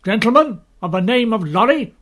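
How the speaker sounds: guard calling sternly for an answer; commanding call. Acted. Guard calls out to passenger.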